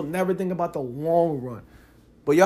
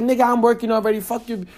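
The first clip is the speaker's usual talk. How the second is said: mock dismissive girlfriend; 7 semitones higher, 5 dB louder than usual. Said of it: "it's like".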